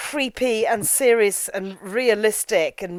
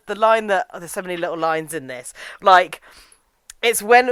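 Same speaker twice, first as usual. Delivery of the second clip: usual speaking voice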